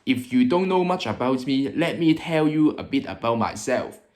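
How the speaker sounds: English with a Hong Kong accent. Shown as a demonstration. YouTuber introducing UK culture shocks, Hong Kong accent. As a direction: speak English like a Hong Konger. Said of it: "I will be speaking with a Hong Kong accent".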